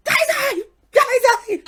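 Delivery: very high, loud shriek of a name; mock hysterical fangirl yell; wild fan scream. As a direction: imitate a drunk fan losing it. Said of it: "fucking loses her shit".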